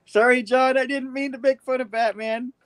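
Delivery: high, giggly apology; apologizing to John while laughing. Real, not acted. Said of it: "Scared him away".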